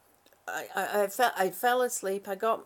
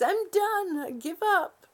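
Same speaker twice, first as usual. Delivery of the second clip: lightly voiced tired brain, a little higher; mock brain giving up, a bit higher; voicing her brain; 8 semitones higher, 3 dB louder than usual. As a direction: let the brain whine that it quits. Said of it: "My brain says".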